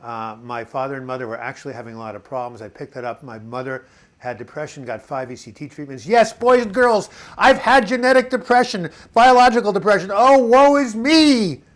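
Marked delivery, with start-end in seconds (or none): 6.1-11.6 loud, high, hammed-up lament; theatrical mock wail, much higher and louder; mock woe-is-me wail. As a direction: belt it out with exaggerated self-pity. Mock self-pity, hammed up for listeners.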